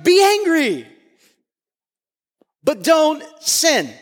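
angry hit high, don't sin slowed and firm; slow emphatic quote. Retold or imitated. Preacher repeating Paul's words, slow heavy stress. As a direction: drag out but don't sin.

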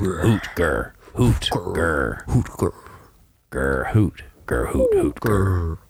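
hooting and growling like an owlbear; playful hoot-and-growl beast noises. Pretend. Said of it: "I do a really good owlbear impersonation".

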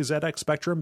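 "Z X Spectrum" with Z as "zed"; ZED, British style, in "Z X Spectrum". Shown as a demonstration. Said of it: "I feel pretentious when I say".

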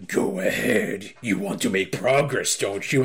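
low, slow, sly villain taunt; a low, slow menacing whisper of a dare. Retold or imitated. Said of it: "Thalamus is like".

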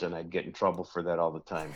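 ordinary delivery

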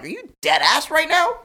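high and slow, pure disbelief; incredulous. Retold or imitated. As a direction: stretch it out, can't believe this guy. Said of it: "I'm looking at him like".

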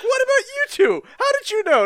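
very high, hammed-up squeal of disbelief; imitating Polnareff's shrill shock; squeaky betrayed friend. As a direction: squeak it like you can't believe they knew.

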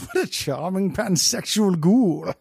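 slow, drawling [name] imitation; a slow, lilting [name] voice; [name] impression. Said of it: "making fun of [name]".

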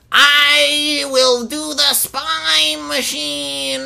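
high, slow mock voice; slow high mock kid; robotic kid voice. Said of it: "it's like".

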